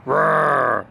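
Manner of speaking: mock roar for a toddler; playful bear roar. Shown as a demonstration. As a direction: growl like a friendly bear. Shows toddler bear sound.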